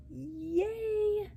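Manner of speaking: high, drawn-out little cheer; celebratory cheer. Real, not acted. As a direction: celebrate with a long, rising squeal. Celebrates finishing the scene.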